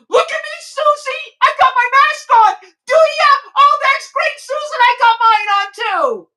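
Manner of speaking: a hammed-up young woman chirping to her friend; mocking young girl. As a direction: parody a girl showing off her mask. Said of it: "young girls between twenty and twenty-five".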